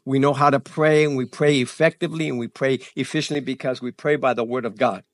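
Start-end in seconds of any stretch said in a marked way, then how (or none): none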